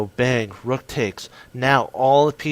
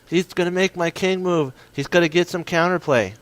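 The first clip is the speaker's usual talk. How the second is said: higher, hammed-up worried voice; mock panicked viewer; 6 semitones higher, 3 dB louder than usual. Chess streamer voicing a worried viewer, mock panic. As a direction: play the viewer who panics over the threat. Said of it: "You're thinking".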